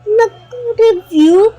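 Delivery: high, loud protesting explanation; loud and high, stumbling over words; shouting. Real, not acted. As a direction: raise your voice to explain yourself. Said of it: "Ba't ka sumisigaw".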